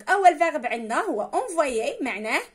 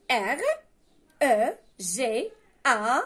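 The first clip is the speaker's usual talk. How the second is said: each letter spelled out slowly; slow spelling; 3 dB louder, much slower than usual. Shown as a demonstration. Teacher spelling Regarder slowly to pupils. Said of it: "كما ينطق يكتب".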